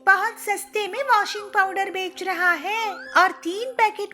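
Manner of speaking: put-on high-pitched eager young bride voice; chirpy young bird. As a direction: show off the bargains, bright and high. Pilu excited about bargain.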